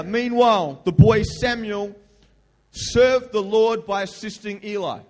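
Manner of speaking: loud, slow Bible reading; preacher reading the Bible aloud; scripture reading. Acted. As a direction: read it out to the church.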